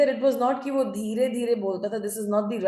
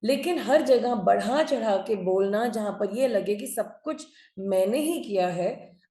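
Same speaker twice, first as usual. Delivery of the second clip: normal talking voice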